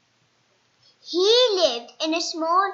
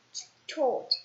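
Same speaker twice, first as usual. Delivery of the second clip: usual speaking voice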